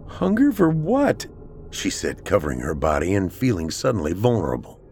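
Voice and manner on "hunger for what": high, drawn-out anxious question; frightened woman. A narrator's reading. Celeste feeling vulnerable as she questions Pat, frightened. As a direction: ask it high and slow, afraid.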